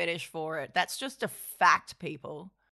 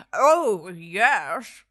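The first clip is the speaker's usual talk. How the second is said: put-on a louder old keeper; lightly voiced old man; 6 dB louder than usual. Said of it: "come up with a voice for this guy".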